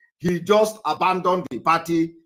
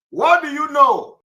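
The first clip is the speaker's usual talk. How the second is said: higher, louder, slower question; loud quiz; 8 semitones higher, 9 dB louder, slower than usual. Real, not acted. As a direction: challenge the class loudly. Teacher quizzing class.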